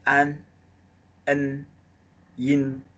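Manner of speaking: an, en, yin sounded through the nose; nasal finals. Shown as a demonstration. Teacher showing the class the nasal finals. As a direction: let an, en, yin resonate in the nose. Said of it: "from the nose".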